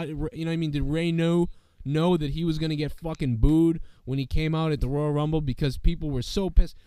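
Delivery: normal talking voice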